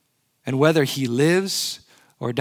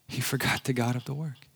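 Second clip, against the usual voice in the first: He forgot the God of the work dropped much softer, almost under the breath; soft echo; 2 semitones lower, 9 dB quieter than usual. Real, not acted. Preacher to congregation, repeating the point in a soft hush. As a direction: let "forgot" land as a hushed repeat.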